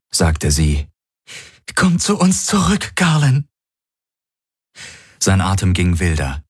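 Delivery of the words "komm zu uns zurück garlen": much higher female voice, earnest; Kirai, urging. Acted. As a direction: coax his memory back. Kirai pleads.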